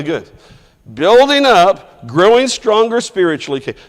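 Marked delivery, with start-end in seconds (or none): 0.9-3.6 emphatic building up. Shown as a demonstration. Teacher hammering home the meaning of edification. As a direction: lean into "Building up" for the class. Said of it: "Remember what we talked about".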